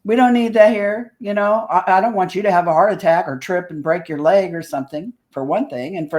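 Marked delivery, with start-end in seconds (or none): 0.0-1.1 higher, louder telling-off of rookies; exasperated scolding. Retold or imitated. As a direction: scold them like a fed-up team leader. Acted scolding of younger investigators.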